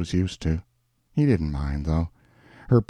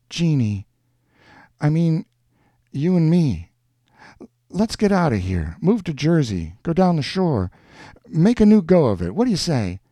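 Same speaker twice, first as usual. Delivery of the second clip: raised, urgent man's pitch; pleading Schultz; 8 semitones higher, 4 dB louder than usual. A narrator's reading. Man begs woman to move to Jersey, earnest pleading. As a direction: build it higher and louder as you urge.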